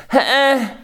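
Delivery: short, high nasal "Heh" of grievance; whiny huff. Shown as a demonstration. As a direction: huff it out like a wronged child. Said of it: "The sound is".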